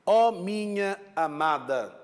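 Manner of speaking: slow, drawn-out reading of a line of verse; slow lyrical reading; verse recitation. Shown as a demonstration. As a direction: recite it like a poem, lingering on each word. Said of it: "Por exemplo".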